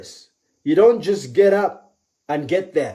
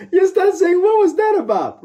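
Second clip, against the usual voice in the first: very high, laughing squeal; laughing incredulously; 14 semitones higher than usual. Acted. Laughing at the alarm scenario.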